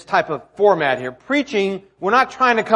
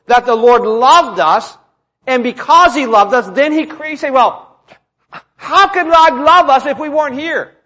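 raised, insistent delivery; emphatic preaching; 6 semitones higher, 7 dB louder than usual. Real, not acted. Preacher correcting a misunderstanding about God's love, emphatic. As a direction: land each point firmly.

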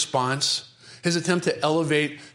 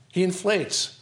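ordinary delivery

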